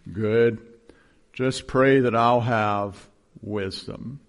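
slow, earnest imitation of Nace. Pastor imitating Nace asking for prayer, slow. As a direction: draw out the request like Nace. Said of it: "he'd say".

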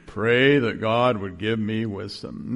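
slow, low imitation of Nace. Pastor imitating Nace on the phone, slow and low. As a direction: plead slowly like Nace. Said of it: "guess what he said to me".

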